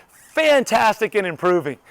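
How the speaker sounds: upbeat show-off answer; enthusiastic. Acted. Answering someone who asks how you are, enthusiastic. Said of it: "weren't acting enthusiastic".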